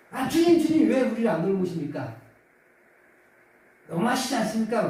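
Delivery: high, loud wounded protest to the Lord; aggrieved complaint. Retold or imitated. Disciples crying out to the sleeping Lord, aggrieved. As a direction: cry out as if wronged and ignored. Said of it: "화가 났습니다. 억울했었나 봐요".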